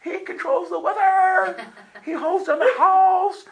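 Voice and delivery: hammed-up gushing praise, slowing down; imagined wife shouting in awe; adoring wife. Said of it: "I'm thinking she's gonna go".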